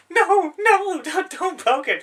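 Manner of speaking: put-on high, loud, terrified child's plea; panicked kid pleading. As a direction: squeal it like a scared little kid begging. Kid panicking at fake crocodile.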